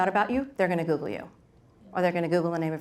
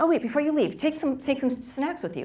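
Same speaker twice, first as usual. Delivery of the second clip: higher, bright sudden offer, slowing on the snacks; eager offer; 8 semitones higher than usual. Retold or imitated.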